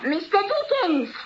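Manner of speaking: muffled call through a closed door; calling from outside. Acted. Landlady calling to Mr. Dickens through the door, muffled and distant. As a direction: call his name from the landing, outside the room.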